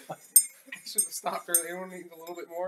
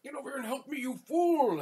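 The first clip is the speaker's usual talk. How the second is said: higher, louder commanding shout; bossy yell; 8 semitones higher, 3 dB louder than usual. Acted. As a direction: bark an order at a lackey. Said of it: "he could go be like".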